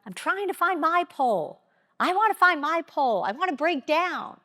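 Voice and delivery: group members running to her, pleading in high excitement; eager group members. Retold or imitated. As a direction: mimic them begging to find their pole. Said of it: "they'd be like".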